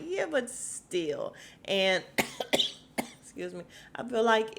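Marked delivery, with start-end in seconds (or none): 0.1-1.3 slow, drawn-out unconvinced voice; skeptical retort. Retold or imitated. Answering Sutton's bacon excuse, slow and doubtful. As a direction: stretch it out, not buying it. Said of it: "And I'm like".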